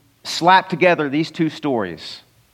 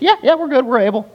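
lightly voiced cocky disciples, pitched much higher; a hammed-up eager yes from the disciples; 7 semitones higher, faster than usual. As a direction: toss it off as boastful know-it-alls. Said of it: "It's humorous what they said".

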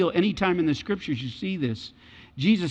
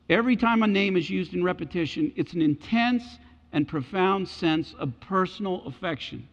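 normal talking voice

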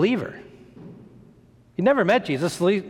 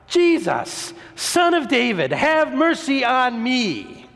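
high, stretched-out cry for mercy; high-pitched, drawn-out pleading call; shouting louder; 6 semitones higher, slower than usual. Acted. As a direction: cry out insistently, dragging out the last words. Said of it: "shouted all the louder".

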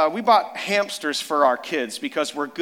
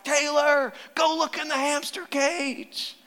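high, slow, eager call to a sleeping daughter; excited wake-up call; 11 semitones higher than usual. Retold or imitated. Father waking daughter Taylor, high and eager. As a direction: sing out her name to wake her with the news. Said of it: "and I'm like".

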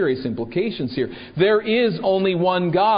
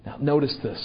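ordinary talk

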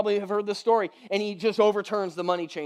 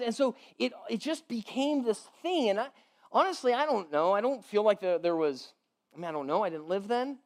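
ordinary talk

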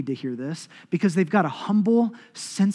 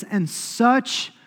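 slow, louder, lifted reading of Paul; slow, solemn, raised scripture recital; 3 semitones higher, 7 dB louder, much slower than usual. Acted. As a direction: declaim the verse slowly, each phrase weighed. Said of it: "He says".

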